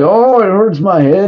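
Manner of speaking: high mock whine of a struggling accent; a hammed-up imitation of her accent; mocking a strained accent. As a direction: lift the voice to mock her trying too hard. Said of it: "She can't think when she's trying to do an accent".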